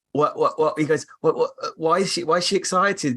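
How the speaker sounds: imitating someone confused, repeating the question words; mock bafflement. Imitating husband puzzled by mum's excitement, mock baffled.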